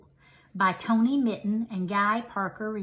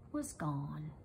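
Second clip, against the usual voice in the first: much softer, lower story ending; fading softly; 5 semitones lower, 16 dB quieter than usual. A narrator's reading. Story closes as the frog vanishes, softer and lower. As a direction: let it fade away gently.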